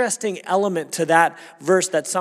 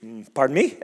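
doubtful pardon me, then a laugh; wife's mock disbelief; 2 semitones higher, 4 dB louder than usual. Wife laughing at the husband's request, amused. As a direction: play the wife incredulous, laughing. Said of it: "And she would be".

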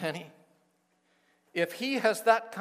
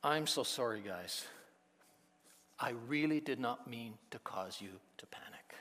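soft sympathetic; 5 semitones lower, 6 dB quieter than usual. Pretend. Imagined sympathetic Jesus.

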